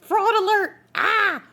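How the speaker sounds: high, loud mock fraud alarm; mock bank alarm. Streamer mocking her bank's fraud alert, high mock alarm. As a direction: squeal it like a panicking bank system. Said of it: "caused them to be like".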